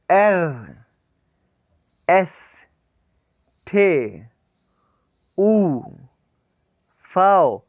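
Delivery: teacher calling German letters; alphabet recitation. Shown as a demonstration. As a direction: keep reciting the letters clearly. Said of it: "मेरे साथ साथ इसको pronounce करना".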